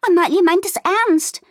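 high, loud agitation; excited cry. A narrator's reading. Fiona reporting back to Wilma, agitated. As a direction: burst in with breaking news. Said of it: "rief Fiona aufgeregt".

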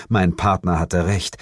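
ordinary delivery

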